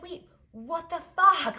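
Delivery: high, loud, slow stunned yell; can't believe what she just heard; astonished outburst. Retold or imitated. Hearing Biddy answer from her heart, loud astonished cry. Shock at Biddy speaking inside.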